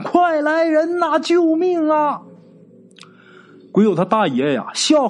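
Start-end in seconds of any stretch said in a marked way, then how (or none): 0.0-2.2 high, slow, drawn-out shout for help; a terrified old landlord calls out for his men; retold cry for help. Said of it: "跑出屋之后就大喊". (高声拖长的呼救)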